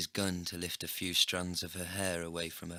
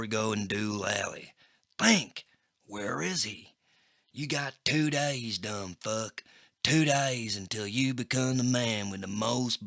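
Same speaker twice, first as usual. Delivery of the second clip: louder, slow gangster menace; menacing Crackjaw; 2 semitones higher, 5 dB louder than usual. A narrator's reading. Crackjaw threatening the narrator, louder slow menace. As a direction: lean on every word.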